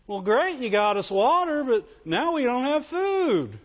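high whining complaint, dragged out; mock whiny Israelites. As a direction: whine like a spoiled child. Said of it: "with the whiny voice".

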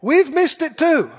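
ordinary talk

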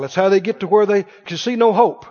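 normal talking voice

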